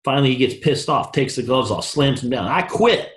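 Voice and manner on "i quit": put-on petulant quitting kid. Kid gives up in story.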